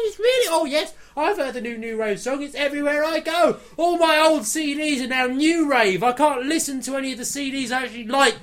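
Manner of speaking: hammed-up rant rising into a high yell; mock rant building to shout. Pretending to hate new rave, rising into a shouted meltdown. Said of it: "where I'm pretending to know".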